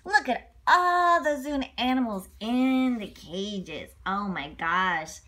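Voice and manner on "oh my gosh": ordinary delivery, nothing marked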